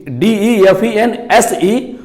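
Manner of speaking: letters D E F E N S E spelled slowly; slow letter-by-letter dictation; spelling out slowly. Shown as a demonstration. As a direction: spell each letter apart. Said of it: "defence".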